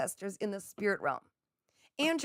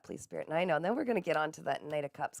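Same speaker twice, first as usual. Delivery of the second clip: ordinary delivery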